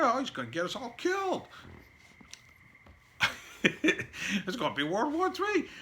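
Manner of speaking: high-pitched mock hysteria; mocking panic. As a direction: parody the doom-sayers in a high squeal. Mocking critics of Trump.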